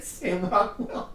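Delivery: high, slow and drawn out, half laughing; mock pleading email. Retelling his fan email to Sam Rockwell, hammed-up and high. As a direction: read your angry fan email out like a hammed-up plea. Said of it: "And I said".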